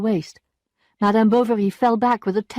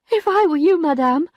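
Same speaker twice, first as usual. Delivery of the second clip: a higher maid's voice, loud rather than sighed; sighing maid; 9 semitones higher, 6 dB louder than usual. A narrator's reading. Maid gently advising her ruined mistress, with a sigh. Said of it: "sighed Félicité at last".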